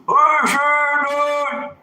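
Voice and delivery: high, loud mocking shout. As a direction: yell it high and loud, imitating someone getting worked up. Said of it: "then they just get loud".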